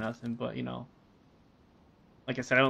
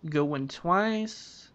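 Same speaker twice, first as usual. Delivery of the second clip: ordinary delivery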